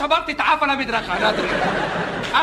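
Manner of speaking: normal talking voice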